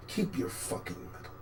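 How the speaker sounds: whispered curse; whispered bitter dismissal. Real, not acted. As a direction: hiss it under your breath. Bitter aside.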